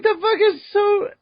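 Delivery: very high squeaky disbelief; incredulous squeal. Retold or imitated. To co-host about Virgo's dancing, squeaky shocked disbelief. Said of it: "I'm like".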